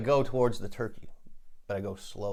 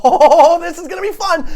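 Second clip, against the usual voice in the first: loud, much higher, thrilled; eager bravado; 14 dB louder than usual. Acted. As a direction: psych yourself up for the fight. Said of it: "I go".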